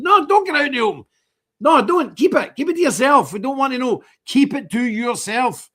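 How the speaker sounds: loud, high, emphatic scolding; exasperated rebuttal. Retold or imitated. Telling his mate to keep feelings private, exasperated. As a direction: forbid it, pitched right up and punched.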